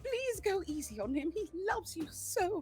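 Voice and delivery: high, earnest plea to spare the brother; pleading charm. Acted. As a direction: plead like big Puss in Boots eyes. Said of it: "charm-filled face they can possibly muster".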